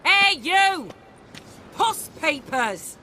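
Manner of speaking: loud, high hail to a stranger; calling out. Acted. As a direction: holler to get someone's attention. Hailing a stranger from afar.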